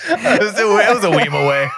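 laughing. Real, not acted. Laughing at silly phrase.

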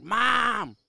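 high, loud retold scream for mom; screamed yell. Kid locked in the room screaming for mom, loud yell. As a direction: holler it out at full pitch. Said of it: "I was yelling on the door, screaming".

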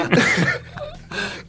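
very high helpless squeal of laughter; high-pitched laughing fit. Real, not acted. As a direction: burst into squealing, real laughter. Cracking up over a name.

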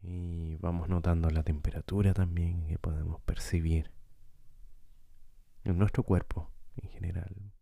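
calm guide with long, unhurried pauses; guided meditation. Acted. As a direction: lead listeners gently through breathing, leaving space between phrases. Guía una meditación de respiración. (meditación guiada, tranquila y pausada)

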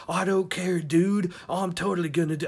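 put-on high-pitched dude-speak accent; rough attempt at her surfer-dude accent; Michelangelo-like accent. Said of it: "just imagine horny Michelangelo".

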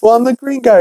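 high, cocky goofy voice; Peter's retort. Acted. Peter answering the red guy, high and cocky. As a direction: toss it back high and proud. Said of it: "And Peter's like".

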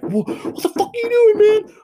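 very high shrieking imitation of a psycho ex; imitated psycho ex. To the viewers, voicing a psycho ex showing up, mock outraged high squeal. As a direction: squeal it like the unhinged ex bursting in. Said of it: "and be like".

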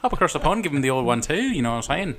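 sparring in mock Irish brogue; Irish accent. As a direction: put on a lively Irish accent. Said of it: "doing a fucking Irish".

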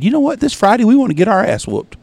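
mocking parents. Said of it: "They said".

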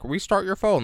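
sing-song mock advice; mocking quote. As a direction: parrot the obvious fix, mocking. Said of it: "like, quoting web fix".

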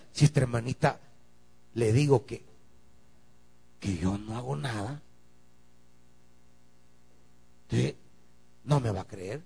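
hushed, low musing to himself; quiet inner thought. Retold or imitated. Pastor muttering his private worry. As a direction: think it over under your breath.

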